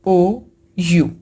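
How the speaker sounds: O, U stretched slowly and clearly, teacher reciting letters; drawn-out vowel names. Shown as a demonstration. Teacher spelling out vowels slowly for the class. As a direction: say ओ and यू long and slow, one by one. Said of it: "ये हमारे पांच जो हैं वो vowels के letters हैं".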